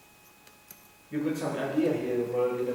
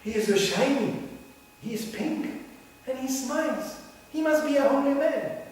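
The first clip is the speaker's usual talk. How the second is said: naive admirer; 8 semitones higher than usual. Retold or imitated. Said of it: "Don't go".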